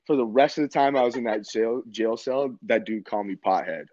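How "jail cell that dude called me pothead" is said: normal talking voice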